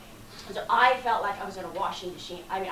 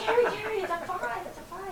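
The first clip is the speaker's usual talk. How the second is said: high, urgent plea to the guide; a mock-frantic high plea; alarmed plea; 11 semitones higher than usual. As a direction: beg Terry in high alarm. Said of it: "And I'm like".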